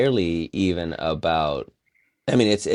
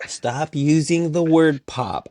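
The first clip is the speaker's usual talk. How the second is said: slow, higher-pitched, emphatic exasperation; exasperated rant; 4 semitones higher, slower than usual. Real, not acted. Artist telling people off over a hated word, exasperated. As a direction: plead in exasperation, leaning on each word. Ranting about a hated art buzzword.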